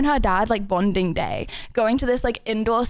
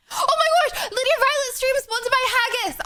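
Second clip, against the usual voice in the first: high, bright burst of delight; high-pitched excited exclamation; excited squeal; 10 semitones higher than usual. Real, not acted. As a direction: gush with excitement. Excited reaction to sponsor joke.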